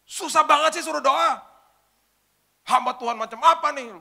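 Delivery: high, loud indignant complaint; retold angry young woman; angry outburst. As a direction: snap at the pastor, offended. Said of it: "Wah, marah-marah tiba-tiba".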